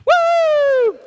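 loud, high whoop of excitement; excited whoop. Real, not acted. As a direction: let out a big whoop to fire up the room. Preacher hypes the crowd.

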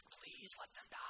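whispered plea, voiceless and hushed; mock whisper. Preacher voicing Jonah's secret wish in a mock whisper.

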